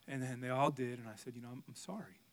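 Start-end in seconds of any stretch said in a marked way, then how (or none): 1.4-2.2 soft, low, hesitant sorry; retold sincere apology. Dad apologizing once the kids forgave him, softly. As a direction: say it softly and humbly.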